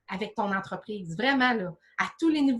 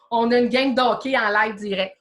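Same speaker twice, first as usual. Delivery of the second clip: said through real laughter, louder; laughing; 7 dB louder than usual. Real, not acted. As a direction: laugh it off while explaining the noise. Laughs at hockey audio leak.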